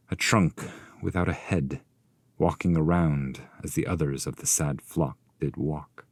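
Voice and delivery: ordinary delivery, nothing marked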